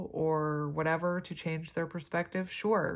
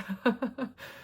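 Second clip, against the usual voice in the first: short laugh; 6 semitones higher than usual. Real, not acted. Laughs at herself.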